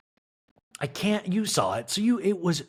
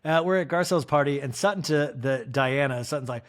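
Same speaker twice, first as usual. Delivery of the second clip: ordinary delivery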